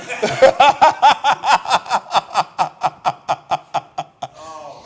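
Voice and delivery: real belly laugh; long hearty laugh. Real, not acted. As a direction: let the laugh run on. Long laugh after the story.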